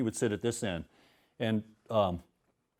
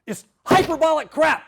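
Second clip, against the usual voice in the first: much higher, loud scornful shout; mock furious yell; 6 dB louder than usual. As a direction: blast the student's story. Furious teacher throws manuscript.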